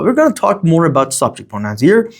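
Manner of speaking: ordinary talk, nothing marked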